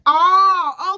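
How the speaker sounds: high, loud, drawn-out "oh"; exaggerated high "oh" of realization; mock realization. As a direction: imitate someone loudly catching on. Acted man catching on.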